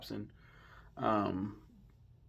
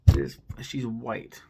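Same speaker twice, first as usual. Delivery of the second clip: loud slow exasperation; 8 dB louder, much slower than usual. Real, not acted. Incredulous complaint.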